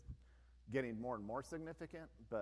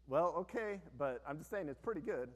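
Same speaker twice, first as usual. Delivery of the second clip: defensive protest; 5 semitones higher, 5 dB louder than usual. Retold or imitated. Retelling own defensive reply to critic.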